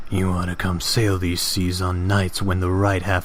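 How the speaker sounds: low-pitched, ominous fish character; deep fish voice. Acted. Fish warns Fry of moonlit seas, deep voice. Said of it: "they should have deep voices".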